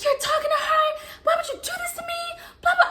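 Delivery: high, wailing mock outburst; fast, shrieked accusation, acted out; hysterical retold yell. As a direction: wail it like a betrayed girlfriend. Acted outburst at cheating boyfriend.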